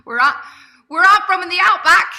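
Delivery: loud, high-pitched bad Australian accent; badly faked Australian twang at a shout; terrible Australian accent. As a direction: belt it out in a hammed-up Aussie accent. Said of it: "That was a terrible Australian accent".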